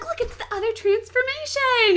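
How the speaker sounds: loud, very high fangirl squeal; excited. Retold or imitated. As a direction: imitate a girl bursting with nerdy excitement. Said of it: "she gets so excited".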